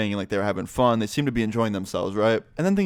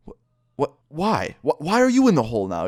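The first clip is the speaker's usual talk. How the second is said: stammered, pitched-up incredulous questions; acted bafflement; 5 semitones higher, 4 dB louder than usual. Teller questioning second friend down in the hole, high bafflement. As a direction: stumble into high, exasperated disbelief.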